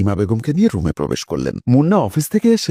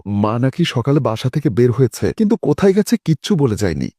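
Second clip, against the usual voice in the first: ordinary delivery, nothing marked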